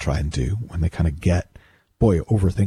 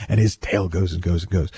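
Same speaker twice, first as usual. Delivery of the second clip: ordinary delivery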